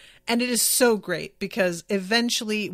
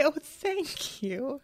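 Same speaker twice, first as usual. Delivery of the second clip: higher, slow, syrupy thank you; sweet mock thanks; 6 semitones higher, 6 dB quieter, much slower than usual. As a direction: mimic a sugary innocent thanks. Orla and mom thanking nun.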